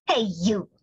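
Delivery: grouchy ladybug. A narrator's reading. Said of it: "said the grouchy ladybug".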